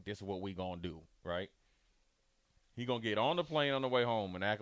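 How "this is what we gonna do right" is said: soft, lightly voiced Arkansas twang. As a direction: Arkansas twang, quiet and understated. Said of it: "in his Arkansas twang".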